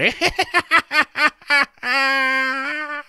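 high, long, manic skull cackle; mad laughter. A narrator's reading. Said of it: "Then it laughed like mad".

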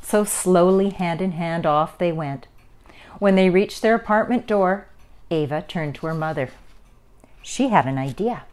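ordinary delivery

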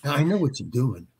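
soft, dismissive retold remark; with attitude. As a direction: imitate his attitude, keeping it quiet. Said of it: "with an attitude".

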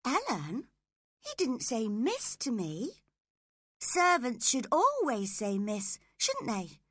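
put-on high girlish voice, pleading; a young girl defending herself, pitched high; spoilt young girl. Girl pleading with her nurse, high girlish voice.

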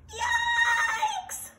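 very high-pitched drawn-out scream; long, high cartoon scream of alarm. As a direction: scream it high, like a germ being washed away. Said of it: "screamed the germ".

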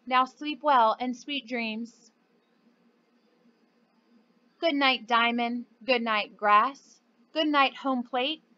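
bedtime picture book read aloud, clear and steady; reading to children. Acted. Teacher reading Good Night Baseball to her students, storytelling voice. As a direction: read it like story time for little ones.

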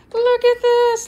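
loud, high delighted call; calling out in pure delight; excited cry. Real, not acted. Showing off the excited cat.

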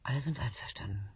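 hushed secret whisper. Acted. Woman whispering a secret to John. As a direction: let it slip under the breath.

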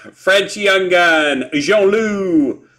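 drawn-out French accent, fresh out of a laugh. Shown as a demonstration. Card breaker making their best effort at a name, French accent. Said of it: "That's my best effort".

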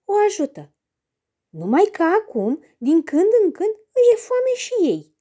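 ordinary delivery

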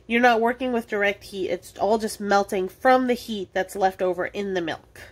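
ordinary delivery

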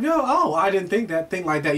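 ordinary talk

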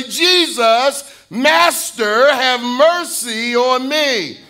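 lepers crying out. Retold or imitated. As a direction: lift your voice and beg the Master. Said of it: "They lifted up their voice".

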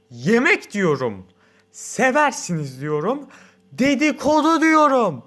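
slow, building from loud to a high shout; like the font growing on the page. A narrator's reading. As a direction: bigger with every word. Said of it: "Giderek sesini yükseltiyor".

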